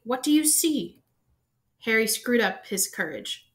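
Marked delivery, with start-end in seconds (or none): 0.0-1.0 high, impatient demand; impatient Quirrell. A narrator's reading. Quirrell impatiently pressing Harry to look in the mirror. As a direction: press him for an answer, impatient. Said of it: "said Quirrell impatiently".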